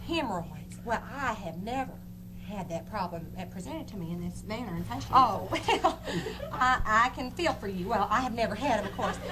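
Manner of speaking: a hint of a southern preacher; preacher character voice. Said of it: "I was Reverend Anita Moore Money".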